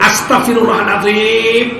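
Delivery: loud, slow, exasperated astagfirullahaladzim; angry istighfar. Shown as a demonstration. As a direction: spit it out in annoyance at the chicken. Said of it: "Istighfarnya marah". (istighfar sambil marah, keras dan lambat)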